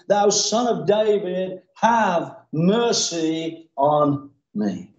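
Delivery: slow, spaced-out cry for mercy; drawn-out plea. Acted. Beggar pleading with Jesus, slow and imploring. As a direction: stretch each phrase, falling toward the end.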